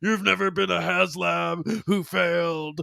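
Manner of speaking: slow wrestling promo growl; Ultimate Warrior impression. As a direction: cut it like a wrestling promo, slowly. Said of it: "You just sound like the Ultimate Warrior".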